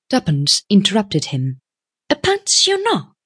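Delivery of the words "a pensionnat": very high-pitched interrupting question; questioning young woman. A narrator's reading. Young woman interrupts the businessman about Paris, questioning. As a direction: break in with a high query.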